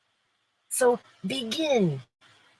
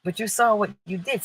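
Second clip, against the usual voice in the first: ordinary talk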